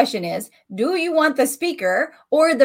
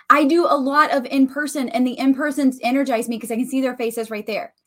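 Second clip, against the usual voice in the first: ordinary talk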